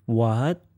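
WHAT starts low and rises; rising tone. Shown as a demonstration. Teacher demonstrates tones with English sounds, rising tone. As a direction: lift WHAT upward like a question. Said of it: "So, the English sounds".

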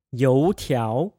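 油条 with both syllables rising; Mandarin tones. Shown as a demonstration. Teacher models 油条 for fried dough fritters. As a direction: lift 油 and then lift 条 again. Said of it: "Y O U and then T I A O, both with second tones".

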